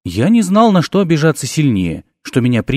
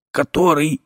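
wheezed; 3 semitones higher, 3 dB quieter than usual. A narrator's reading. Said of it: "просипел я".

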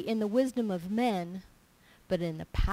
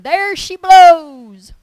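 high, drawn-out holler; huge, slow, high-pitched blurted cry; spontaneous triumphant shout; 8 semitones higher, 23 dB louder, much slower than usual. Retold or imitated. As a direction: belt it out like a whaler's cry. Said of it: "without even thinking".

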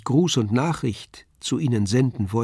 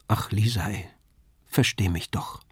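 a hushed, near-whispered plea, much softer; 3 semitones lower, 6 dB quieter than usual. A narrator's reading. Paul pleads with Lisei to understand, whispering. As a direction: beg her gently under the breath. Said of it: "ich bat nur".

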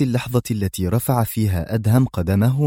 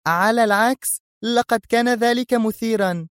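put-on higher thrilled girl's voice; excited young woman; sudden excited cry; 13 semitones higher than usual. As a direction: gush with delight. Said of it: "هتفت الفتاة فجأة".

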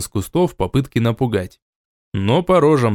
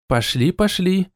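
smiling; 8 semitones higher than usual. A narrator's reading. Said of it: "улыбаясь".

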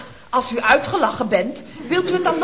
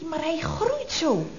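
normal talking voice

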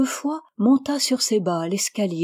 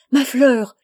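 loud, high anguished cry; a father crying out to his sick child; cri désespéré; 4 semitones higher, 8 dB louder than usual. A narrator's reading. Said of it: "cria Gence comme un fou". (cri angoissé d'un père, fort et aigu)

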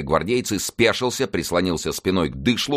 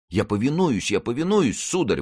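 hurried exclamation; 6 semitones higher than usual. A narrator's reading. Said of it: "воскликнул Бруссель".

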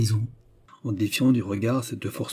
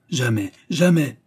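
slow, louder, defiant refusal; 3 semitones higher, 5 dB louder than usual. A narrator's reading. Accused refuses to kneel before the clerk, defiant. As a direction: refuse firmly, drawing out each word.

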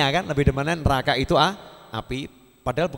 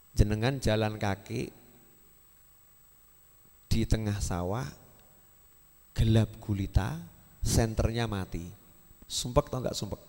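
hushed storytelling; 4 semitones lower, 4 dB quieter than usual. Acted. Quiet dark-night story example.

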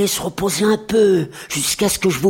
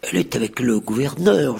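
plain reading voice

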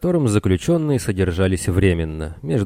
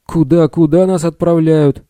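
high, loud, breathless alarm; gasping in shock; 9 semitones higher, 6 dB louder than usual. A narrator's reading. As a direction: choke out the question in panic. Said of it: "задохнулся Билл".

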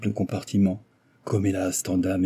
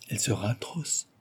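murmured; 8 dB quieter than usual. A narrator's reading. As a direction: let it drop to a murmur. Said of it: "murmura le jeune homme".

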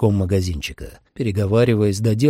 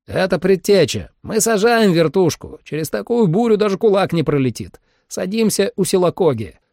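radio call; 10 semitones higher than usual. Acted. Team leader calling over radio.

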